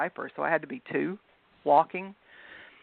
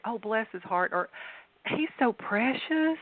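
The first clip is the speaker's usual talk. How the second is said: slow, higher, drawn-out precious; fake baby praise; 6 semitones higher than usual. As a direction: gush over an ugly baby, faintly insincere. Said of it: "they're just not the prettiest baby".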